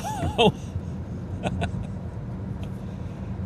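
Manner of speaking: high breathy burst of laughter. Real, not acted. Speaker laughing at the story, delighted laugh. Laughs after the crying line.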